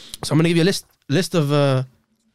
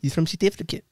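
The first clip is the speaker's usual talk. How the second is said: softer put-on South African; mock South African; South African accent; 5 dB quieter than usual. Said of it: "South African".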